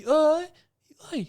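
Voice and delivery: mournful call. Retold or imitated. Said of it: "Dia cakap".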